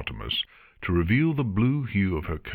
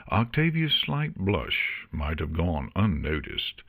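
ordinary delivery

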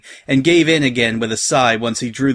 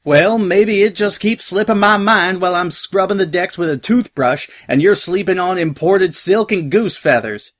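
higher, twangy indignant retort; riled farm girl having it out; Applejack shouting back; 7 semitones higher, 4 dB louder than usual. A narrator's reading. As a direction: fire back loudly in a country accent. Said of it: "Applejack shouted back".

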